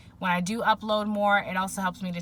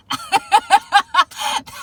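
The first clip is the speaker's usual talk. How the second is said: high laugh; 12 semitones higher, 13 dB louder than usual. Real, not acted. Laughing at her own retelling.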